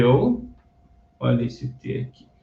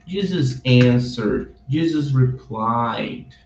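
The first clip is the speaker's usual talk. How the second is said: slow model reading; 2 semitones lower, much slower than usual. Shown as a demonstration. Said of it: "teacher demonstrates the verse phrases".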